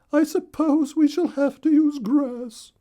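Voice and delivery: very high, loud little bird wife voice; Missus Weaver voice. Acted. Missus Weaver wishing for nest thread, squeaky high bird voice.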